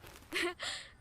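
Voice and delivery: nervous laugh. Acted. Stranger's flirting makes her awkward.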